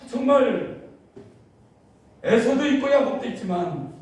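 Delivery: high, loud pleading prayer that sinks to a hush; impassioned prayer. Acted. Pastor reenacting his own prayer to God, pleading and fervent. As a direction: cry out to God, then drop to a near whisper.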